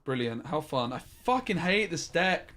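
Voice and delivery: ordinary talk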